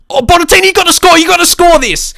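high, loud yell at the striker; excited shout. Real, not acted. Gamer yelling at Bonatini to score, high and loud. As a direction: scream him toward the goal. Big chance, cheering striker.

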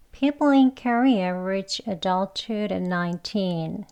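every word of the sentence slowly and evenly spaced; slow careful reading. Shown as a demonstration. Teacher reading an example sentence slowly for learners. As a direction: read it out very slowly for the class. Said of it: "속도는 좀 천천히 할게요".

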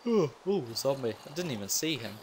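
high startled yelp. Real, not acted. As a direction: jump at it. Zombie appears suddenly.

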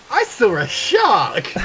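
high, drawn-out mock exclamation; mocking echo. Teasing a friend's shark announcement, mocking echo. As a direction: stretch it out in exaggerated excitement.

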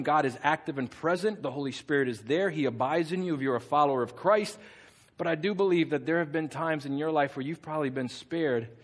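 normal talking voice

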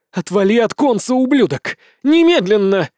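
loud furious yell; thunderous shout. A narrator's reading. Shango shouting furiously at Kane to let go. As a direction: blast it at him, full voice. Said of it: "громогласно воскликнула Шанго".

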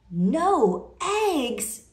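loud, high outraged cry; a farmer yelling at bad news; cried out. Farmer learning the hens stopped laying, loud outraged cry. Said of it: "cried Farmer Brown".

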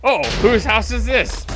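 ordinary talk

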